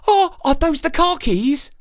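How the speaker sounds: put-on high, loud surprised child; a boy at the window seeing the crow with keys, high and excited. A narrator's reading. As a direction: gasp it out in delight. Jake excited.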